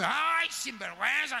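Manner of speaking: high, slow mocking scold; scornful scolding. Churchgoer scolding a repentant sinner, high scornful mock. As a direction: sneer at the sinner, high and drawn out.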